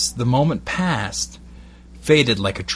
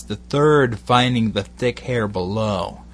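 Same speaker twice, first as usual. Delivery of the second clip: plain reading voice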